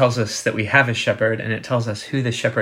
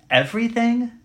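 high, rising disbelief; incredulous question; 12 semitones higher, 4 dB louder than usual. Acted. Preacher voicing a listener who finds it extreme, incredulous. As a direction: question it sharply, pitched way up. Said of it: "Now that sounds extreme, doesn't it?".

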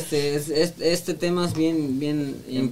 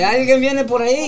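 louder, higher call to the room; 7 semitones higher, 8 dB louder than usual. Real, not acted. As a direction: call out as someone arrives. Group announces a surprise interruption.

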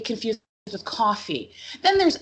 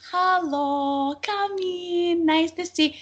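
higher, welcoming immigrant mom voice; mom greeting guests; 8 semitones higher, much slower than usual. Retold or imitated. Immigrant mom welcoming her work crew at the door, higher voice. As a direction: sing out a bright hostess hello. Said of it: "there's my mom".

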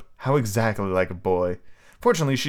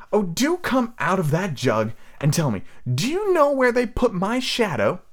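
put-on high boy voice; 10 semitones higher than usual. Peter voiced in story.

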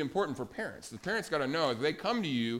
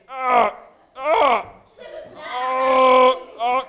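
hammed-up yelling, much louder and higher; loud mock cries of struggle; 9 semitones higher, 14 dB louder, much slower than usual. Preacher blinded by a log, hammed-up yells.

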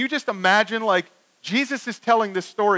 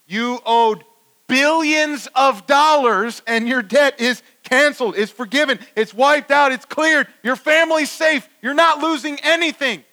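raised, emphatic, incredulous rebuke; indignant protest; 7 semitones higher, 5 dB louder than usual. Acted. Preacher confronting the forgiven servant, raised indignant protest.

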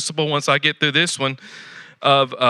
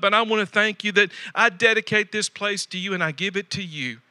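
spoken prayer; 3 semitones higher than usual. Acted. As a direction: pray it humbly to the Lord. Voices a model prayer.

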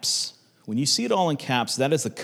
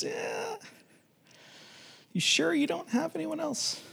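squeaky-high, hesitant doubt; doubtful; 7 dB quieter than usual. Retold or imitated. Preacher voicing Jehoshaphat doubting Ahab's prophets, high and hesitant. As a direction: draw back into a high, softer, skeptical query.

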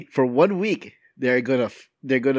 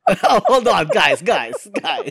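loud, higher, through laughter; laughing; 6 semitones higher, 5 dB louder, much slower than usual. Real, not acted. Host trying to halt co-hosts over an innuendo, laughing. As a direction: call for a pause while cracking up.

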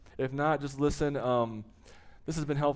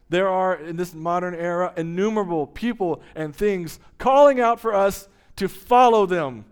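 rising preaching; 4 semitones higher, 8 dB louder than usual. Real, not acted. Building sermon climax.

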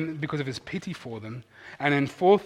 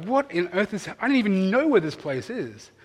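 bewildered reader; 6 semitones higher than usual. Retold or imitated. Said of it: "you're like".

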